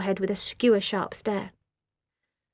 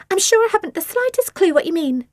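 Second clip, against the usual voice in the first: higher fake-innocent denial; lying innocence; 9 semitones higher, 4 dB louder than usual. A narrator's reading. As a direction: feign innocence. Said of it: "she lied".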